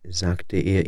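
plain reading voice

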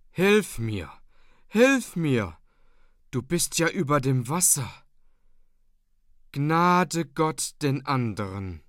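pleading cry for help that slows into a grave curse; desperate plea fading into a slow doom warning; dying woman's cry. Acted. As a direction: call out for help, then drag out the last words. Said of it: "Und kreischend, wie ein Notschrei".

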